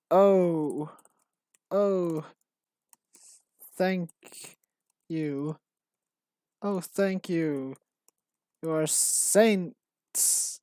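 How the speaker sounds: lightly hammed-up, drawn-out gushing thanks; dramatic grateful NPC. Quest giver gushing thanks to the player, mildly hammed-up. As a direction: overplay the thanks like a melodramatic villager. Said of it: "he's really dramatic about it".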